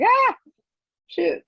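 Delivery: mock-alarmed outburst; startled yelp. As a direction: act out a sudden "oops" moment. Retelling her shock at misreading.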